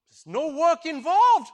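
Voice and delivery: slow, loud sales pitch; big shout. Retold or imitated. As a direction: stretch it out and belt it. King's offer, slowed and shouted.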